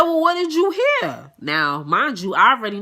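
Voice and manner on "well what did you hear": high-pitched, pointed challenge; challenging question. Retold or imitated. Officer asking her supervisor about the rumour, challenging. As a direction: demand it in a raised pitch.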